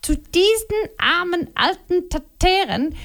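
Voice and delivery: mock her husband's excuses with scorn; Liliana, accusing. A narrator's reading. Wife accusing her husband before Momo, scornful.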